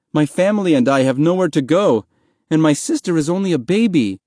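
pleading. A narrator's reading. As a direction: make your case to a stranger, pleading. Boy pleads with a stranger.

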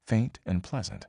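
ordinary delivery

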